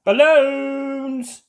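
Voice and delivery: high, loud seller cry; shouting. A narrator's reading. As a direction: shout the wares. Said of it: "shouts Pedro Pony".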